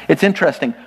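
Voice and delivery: ordinary talk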